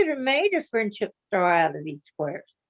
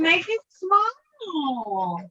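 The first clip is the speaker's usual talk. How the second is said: slow, high drawn-out order; mock designer voice; 7 semitones higher, much slower than usual. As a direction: stretch it out, mocking the designers. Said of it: "They're thinking".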